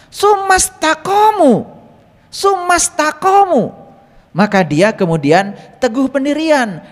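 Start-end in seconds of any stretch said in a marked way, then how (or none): 0.1-3.8 slow recitation. Acted. Reciting the verse phrase slowly.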